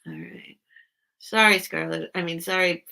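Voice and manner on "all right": quick hushed whisper to herself. Real, not acted. Streamer muttering to herself, whispered. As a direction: breathe it out unvoiced, a private aside.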